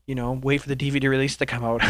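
normal talking voice

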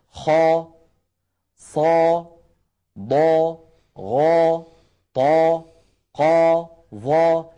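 خا، صا، ضا each loud, full and dark; heavy letters demo. Shown as a demonstration. Teacher drills viewers at home, heavy خا to ظا. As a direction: press خا through ظا up to the palate, one by one. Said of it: "جربوا هكذا لو سمحتم معنا".

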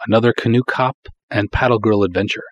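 ordinary talk

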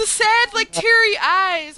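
high, loud gushing over teary eyes; gushing squeal. Real, not acted. Fan gushing to friends about teary eyes, high squeal. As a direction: squeal it out, overcome.

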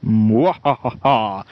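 slow theatrical villain laugh; evil cackle. Acted. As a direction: cackle like a pantomime baddie. Sketch villain gloats over scheme.

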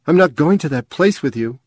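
loud, defiant refusal; angry refusal. A narrator's reading. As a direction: shout her refusal at her mother. Girl refuses her mother.

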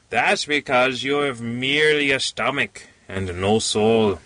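usual speaking voice

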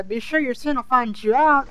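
normal talking voice

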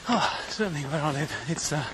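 ordinary talk, nothing marked

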